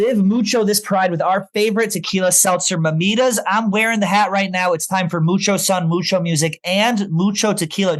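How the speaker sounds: ad read. Acted. Host pitching the sponsor's seltzer to listeners, upbeat ad read. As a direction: sell it like a radio spot.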